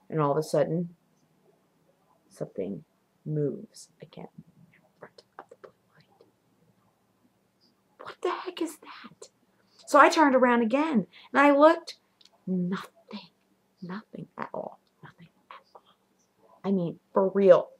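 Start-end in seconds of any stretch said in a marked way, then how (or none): 2.3-9.3 hushed suspenseful storytelling whisper; spooky whisper. Retold or imitated. Telling of a shape crossing the blue light, spooky whisper. 12.5-15.8 whispered, fading "nothing"; hushed disbelief. Retold or imitated. Turned around and looked, found nobody there, hushed eerie whisper.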